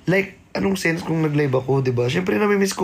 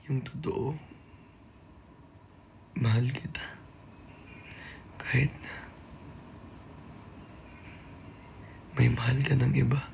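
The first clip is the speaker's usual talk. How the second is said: much softer, lower, hushed lines; dramatic soft confession; 6 semitones lower, 12 dB quieter, faster than usual. Acted. Acting a lovelorn confession to the viewers, in a hushed, soft voice. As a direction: confess it in a near whisper.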